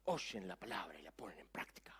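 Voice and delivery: breathy whispered question. Acted. Preacher whispering the answer to his congregation. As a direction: drop to a hush on the answer.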